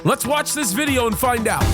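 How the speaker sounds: usual speaking voice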